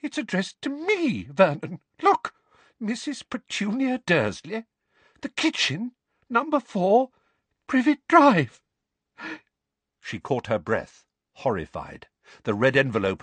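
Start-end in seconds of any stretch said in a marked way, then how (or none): 0.0-8.6 very high, frightened voice building louder; shaking voice. A narrator's reading. Aunt reading the address to her husband, shaking voice. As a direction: read it out in rising panic. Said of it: "in a shaking voice".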